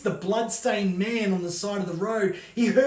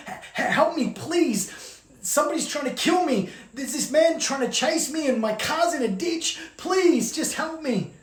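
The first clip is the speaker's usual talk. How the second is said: panicked pleading; 6 semitones higher than usual. Acted. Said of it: "She's like".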